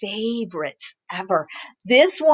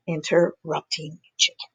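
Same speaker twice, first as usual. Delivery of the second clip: plain reading voice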